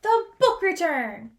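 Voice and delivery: slow, loud exclaim of discovery; excited reveal. A narrator's reading. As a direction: announce the find with delight. Discovery climax in story.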